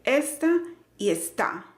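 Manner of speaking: slow "esta y está", first ES stressed, then TÁ; contrasted word stress. Shown as a demonstration. Teacher pointing out the difference, contrasted stress. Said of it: "para que notes la diferencia".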